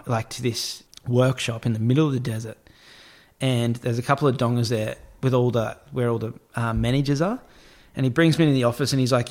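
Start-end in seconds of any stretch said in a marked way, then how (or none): none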